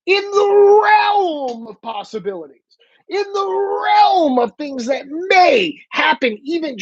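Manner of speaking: REALM stretched out high and slow; incredulous emphasis. Real, not acted. Host asking if they think cops would beg, high drawn-out "realm". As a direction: hold "realm" long on a high, exasperated pitch. Incredulous rhetorical question, ranting.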